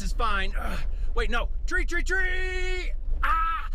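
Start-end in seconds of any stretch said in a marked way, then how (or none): 1.7-3.7 high, slow alarmed cry; panicked tree warning. Acted. About to crash.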